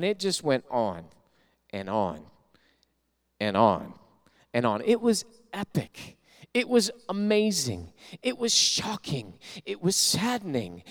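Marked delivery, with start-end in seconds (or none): 4.9-10.8 slow dramatic list. Acted.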